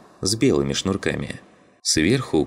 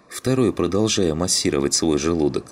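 ordinary delivery

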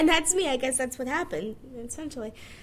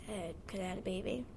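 usual speaking voice

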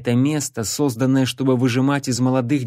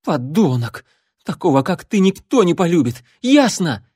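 blurted out; 6 semitones higher, 4 dB louder than usual. Acted. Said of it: "выпалила Нола".

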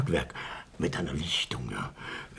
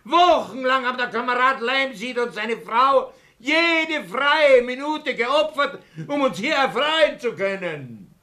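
indignant; 10 semitones higher than usual. Acted. Indignant scolding of the hecklers.

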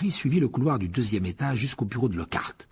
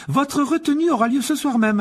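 much higher, crisp stern teacher; McGonagall; 6 dB louder than usual. A narrator's reading. McGonagall catching the boys in the hall, stern and high. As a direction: address them like a strict professor. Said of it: "l'air sévère".

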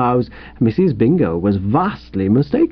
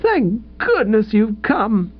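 high, slow adoring relief; thrilling wifely love; 8 semitones higher, slower than usual. A narrator's reading. Wife to the husband come to rescue her, thrilled and loving. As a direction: linger on it, high and full of love. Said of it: "wifely love thrilling in every syllable".

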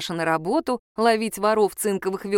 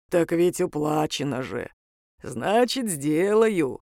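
low creature voice, matter-of-fact; deep-voiced obliging demon; 4 semitones lower, slower than usual. A narrator's reading. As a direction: agree readily in a demon's bass. Demon accepts the job.

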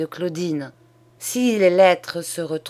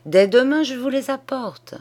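higher, emphatic burst of eagerness; eager offer; 7 semitones higher, 4 dB louder than usual. A narrator's reading. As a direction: jump in and promise it at once. Old man eagerly offers his money. (offre empressée, voix plus aiguë)

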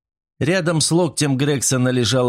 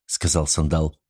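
ordinary delivery, nothing marked

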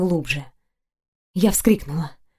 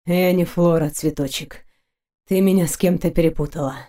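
lightly voiced man, lower and easy; teasing knight; 4 semitones lower, 3 dB quieter than usual. Wounded knight teases his lover, lightly voiced low banter. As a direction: drop the pitch, softer on the pet name.

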